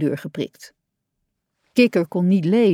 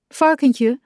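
higher-pitched call to a friend; aanroepend; 6 semitones higher, 4 dB louder than usual. A narrator's reading. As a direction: call out a name to get attention. Kikker roept Varkentje aan. (roepend, iemand aansprekend)

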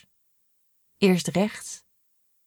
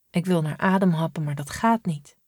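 ordinary delivery

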